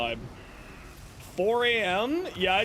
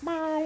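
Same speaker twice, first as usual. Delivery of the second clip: imitating cat; 10 semitones higher than usual. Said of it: "and he was like".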